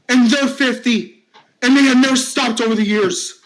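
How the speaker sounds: high, strained ranting with bursts of shouting; distraught bullied man. Acted. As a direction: vent every hurt in one rush. Finn pours out his pain.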